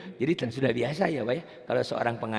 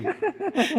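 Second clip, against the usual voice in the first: brief high-pitched giggle; high laugh. Real, not acted. Teacher joking about hurt feelings, high laugh. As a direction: let out a short, high laugh.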